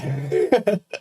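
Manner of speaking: short laugh rising in pitch; self-mocking laugh. Real, not acted. Storyteller laughing at his younger, cockier self, amused laugh. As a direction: laugh at your own folly.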